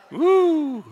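a high, loud "woo" of joy; exultant whoop. Real, not acted. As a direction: let out a high joyful whoop. Preacher exults after laughing.

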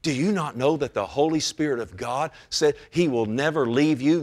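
ordinary talk, nothing marked

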